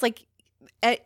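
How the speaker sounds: usual speaking voice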